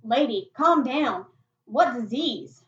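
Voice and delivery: loud, slow plea to calm down; loud calming protest. Acted. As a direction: raise your voice, drawing out the words. Startled boy facing shotgun in story.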